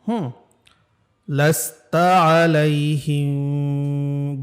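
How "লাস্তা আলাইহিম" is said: লাস্তা আলাইহিম chanted slowly with a nasal hum on the ম; with ghunna. Shown as a demonstration. Teacher chanting slowly to show the ghunna. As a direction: hum the ম of আলাইহিম through the nose. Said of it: "গুন্না হবে".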